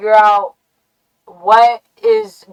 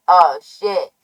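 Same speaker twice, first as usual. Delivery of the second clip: ordinary talk, nothing marked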